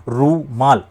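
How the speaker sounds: रूमाल slowly as "रू-माल"; syllables split right. Shown as a demonstration. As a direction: break it into रू and माल. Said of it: "ये सही है". ("रू-माल" धीरे, सही तोड़कर)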